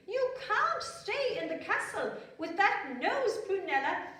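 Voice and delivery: high, loud royal pronouncement; stern queen. Acted. Queen sends her daughter off, high and loud. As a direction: lay down the law with a laugh inside. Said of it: "said the queen".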